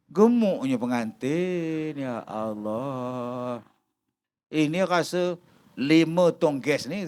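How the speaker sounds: slow, soft gossiping gasp at the bride; gossiping guest. Retold or imitated. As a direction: drawl it out like a nosy guest. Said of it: "kata dia". (mengumpat perlahan dan meleret)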